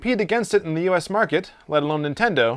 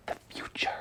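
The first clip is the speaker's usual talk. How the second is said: whisper; 14 dB quieter than usual. Acted. Host reacts to a taped call.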